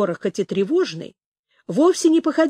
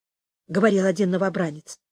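usual speaking voice

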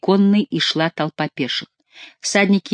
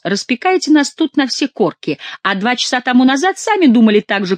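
higher, louder reproach; indignant retort; 7 semitones higher, 4 dB louder than usual. A narrator's reading. Quélus tells Chicot he thought the same, indignant retort. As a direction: throw the blame back, voice raised.

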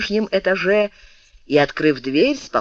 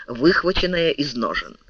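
plain reading voice

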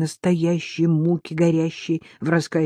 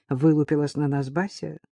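ordinary delivery

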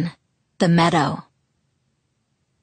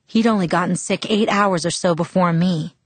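usual speaking voice

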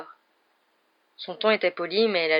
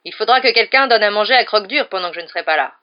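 louder and higher, a booming call; 4 semitones higher, 10 dB louder than usual. A narrator's reading. Hagrid leaving, calls back about feeding Croque-Dur, loud. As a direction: call it out to the room. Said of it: "dit d'une voix forte".

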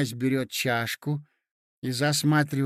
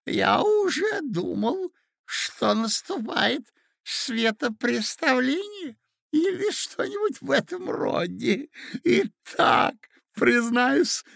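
put-on very high, quavering old man; excited old prince; 9 semitones higher, 3 dB louder than usual. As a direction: babble it out, aghast. Old prince telling accident.

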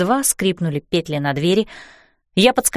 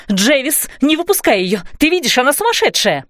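high panicked yell; 6 semitones higher than usual. A narrator's reading. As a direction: shriek for help. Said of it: "вопила Донателла".

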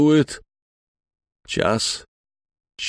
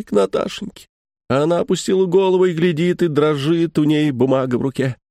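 ordinary delivery

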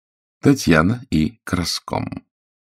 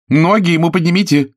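high, loud hostess cry; shouting; 13 semitones higher, 6 dB louder than usual. A narrator's reading. Lena orders the guests about, shouting. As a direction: yell it across the room. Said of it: "закричала Лена".

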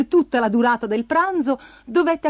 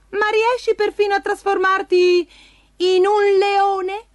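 high, wondering cat voice; goading cat; 9 semitones higher, 4 dB louder than usual. A narrator's reading. Cat goads ogre into changing shape, high questioning voice. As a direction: dare him with a high question, slowing on the last words.

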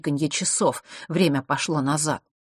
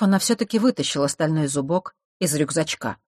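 usual speaking voice

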